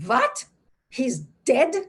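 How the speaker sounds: high, loud shocked what; alarmed, high-pitched disbelief. Retold or imitated. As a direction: recoil at the news he's dead. Workman mishears deaf as dead.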